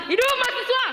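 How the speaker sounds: high rousing chant; rally speech. Real, not acted. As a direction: fire up the crowd, pitch soaring. Activist opens with rally chants. (pekikan orasi)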